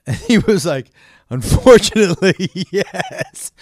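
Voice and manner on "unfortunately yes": laughing. Retold or imitated. Quoting partner, cracking up.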